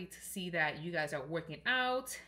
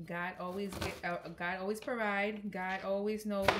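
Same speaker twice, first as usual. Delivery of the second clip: usual speaking voice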